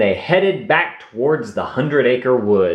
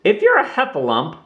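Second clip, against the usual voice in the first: put-on wondering young Roo; 13 semitones higher than usual. A narrator's reading. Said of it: "wondered Roo".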